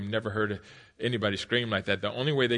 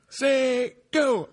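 coach shouting; 14 semitones higher, 6 dB louder than usual. Retold or imitated.

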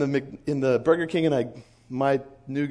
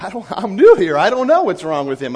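loud, higher-pitched disclaimer; flustered protest; 5 semitones higher, 10 dB louder than usual. Retold or imitated. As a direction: blurt it out, disowning your colleague. New guy disowns his odd colleague.

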